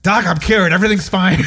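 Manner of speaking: put-on old patient proudly back from the bathroom; old patient's voice. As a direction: announce the cure like a relieved old fellow. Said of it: "was that his voice".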